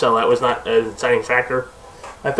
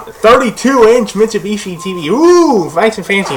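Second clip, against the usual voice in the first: high-pitched, loud pretend awe; mock impressed; 8 semitones higher, 4 dB louder than usual. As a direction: ooh and aah over it in mock wonder, pitch swooping up.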